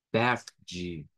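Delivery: "verde" with a guttural r and a ji ending, like VEH-jee; the common Brazilian way. Shown as a demonstration. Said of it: "que sería verde". ("verde" con R gutural, "vérdji")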